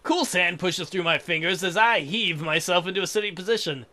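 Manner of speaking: ordinary delivery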